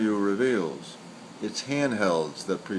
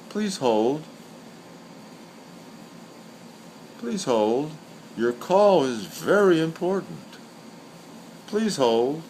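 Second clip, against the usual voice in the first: automated hold message; 2 semitones higher than usual. Retold or imitated. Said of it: "the automated voices that keep you on hold".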